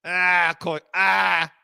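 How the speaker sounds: mock hurried 'I'll call you back', repeated; tossing off a quick brush-off line; imitating dad's phone sign-off. Friend imitating his father hanging up, mock brush-off. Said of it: "the statement is always".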